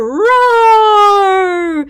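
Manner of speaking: loud roaring sea monster. Acted. Playing the sea monster for a child, roaring. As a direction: roar like a monster.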